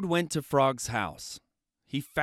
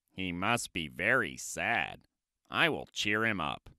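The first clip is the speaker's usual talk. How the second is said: much lower, sympathetic Toad; pitying Toad; 8 semitones lower, 6 dB quieter than usual. Acted. Toad seeing Frog alone, low pity. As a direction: sigh it as a sorry Toad.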